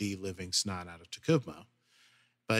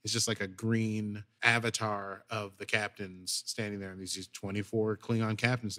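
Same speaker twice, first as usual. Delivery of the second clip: ordinary talk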